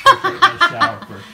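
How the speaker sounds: laughing through the words. Real, not acted. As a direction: blurt it out while cracking up. Laughing correction about bathtub.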